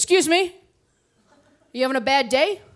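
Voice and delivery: retold pushy, in-your-face question; sassy. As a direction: confront a stranger with mock concern. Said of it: "I got a real sassy".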